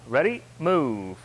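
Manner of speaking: slow, drawn-out command to begin; drill command. Real, not acted. Instructor starting the class on rising knee, drill command. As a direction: stretch out the call to move.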